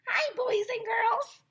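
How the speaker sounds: very high sing-song clown voice; clown lady greeting. Acted. Clown lady greeting the class, high sing-song voice. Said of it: "the clown lady said".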